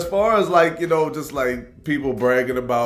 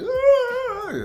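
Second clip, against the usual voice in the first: mock yelp; 7 dB louder than usual. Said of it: "And I'm just like".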